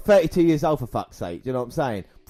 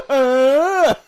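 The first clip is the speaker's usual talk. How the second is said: long, high, loud mock moan; drawn-out wail of a floored fighter; hammed-up groan breaking into laughter; imitated knocked-out groan; 13 semitones higher, 8 dB louder than usual. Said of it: "like that".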